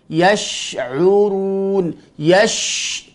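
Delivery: slow YASH'URUN with a held SH; flowing shin. Shown as a demonstration. Teacher giving another example, drawn-out SH in YASH. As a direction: let the hush in YASH run before moving on. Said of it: "Contoh lagi".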